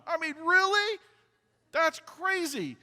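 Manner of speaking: very high, loud disbelief; stretched-out, high-pitched astonishment; incredulous. Retold or imitated. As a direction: squeal it in amazed disbelief. Disbelief at the perfect tool pick.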